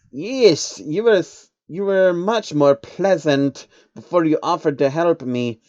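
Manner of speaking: high creature voice, mock grumble; sulky little alien complaining; grumbling changeling. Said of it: "the Changeling grumbled".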